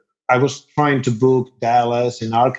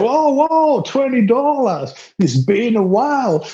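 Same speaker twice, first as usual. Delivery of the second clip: much higher, slow, stunned "twenty dollars"; a musician floored by a big tip; amazed whoa; 12 semitones higher than usual. Retold or imitated. Band reacts to a big tip.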